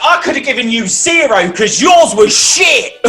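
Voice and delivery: high, loud angry shout; hammed-up furious yell; mock contestant shouting her rage; furious outburst. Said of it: "she goes".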